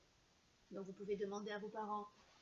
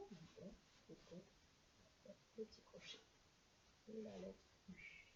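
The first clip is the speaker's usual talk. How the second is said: softer whispered aside; teacher whispering while tracing letters; 13 dB quieter than usual. Shown as a demonstration. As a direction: murmur it while writing.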